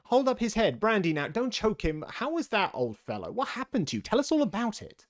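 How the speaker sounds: high, hurried fussing crowd; confusion of voices. A narrator's reading. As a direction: several helpers clamouring at once. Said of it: "another confusion of voices".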